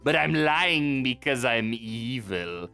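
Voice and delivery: put-on villain voice; hammed-up villain greeting; evil circus boss. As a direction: play a scheming circus owner, drawing out the ending. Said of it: "this guy's like".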